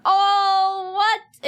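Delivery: high, loud startled oh what; sudden squeaky surprise; startled yelp. Real, not acted. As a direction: jump in surprise, voice shooting up. Startled by a chat message.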